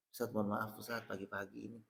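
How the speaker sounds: hesitant guest. Acted. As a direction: rush it out, ashamed to ask.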